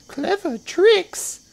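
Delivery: high, loud, drawn-out squeal of disbelief; incredulous little crocodile. A narrator's reading. Not-so-big crocodile doubting clever tricks, high incredulous cry. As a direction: cry it slowly in a tiny high voice. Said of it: "cried the not-so-big one".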